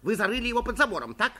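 urgent, insistent accusation. A narrator's reading. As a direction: press the suspect for a confession. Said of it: "настойчиво повторил профессор".

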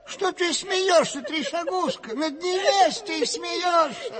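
high-pitched, worked-up telling-off; old man's indignant outburst; indignant scolding. Acted. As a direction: scold the one who is laughing at you. Old man scolds a laughing listener.

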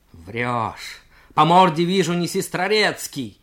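loud furious yell, punching морде. Acted. Junker calling the worker a liar, loud furious yell. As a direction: roar it right at him. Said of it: "заорал юнкер".

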